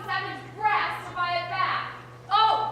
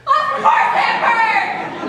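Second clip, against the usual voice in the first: loud, drawn-out retort; indignant shout; 3 semitones higher, 10 dB louder, slower than usual. Acted. Bitten daughter asked if it hurt, indignant shout. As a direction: bellow it slowly, aggrieved.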